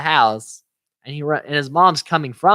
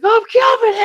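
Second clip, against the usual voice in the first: much higher, louder goofy sidekick; goofy eager call; 7 dB louder than usual. Retold or imitated. Marv answering, high and keen.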